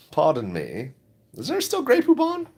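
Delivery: put-on high-pitched advert voice; old commercial character. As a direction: imitate the old mustard commercial, voice jumping high. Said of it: "Remember those old commercials?".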